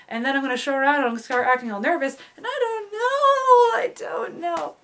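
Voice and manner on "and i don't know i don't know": very high, slow, crying wail; mock despairing wail. Ending the dilemma rant with a high crying wail. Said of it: "dramatic despair ending rant".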